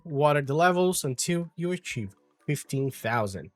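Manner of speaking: usual speaking voice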